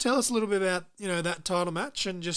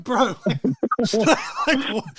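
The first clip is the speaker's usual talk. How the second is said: laughing; 5 semitones higher, 7 dB louder than usual. Retold or imitated. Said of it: "laugh heard on these lines".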